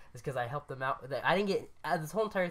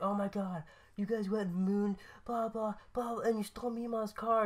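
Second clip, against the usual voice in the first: higher-pitched imitation of dad's scolding, not shouted; mock telling-off at everyday volume; retold yelling; 6 semitones higher, 4 dB quieter than usual. Dad scolds over the mooning, retold yelling. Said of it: "just yelling at me".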